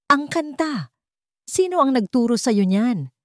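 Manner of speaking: pressing the question hard; insistent demand. A narrator's reading. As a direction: demand an answer, shaken. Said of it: "Iginiit ng matanda".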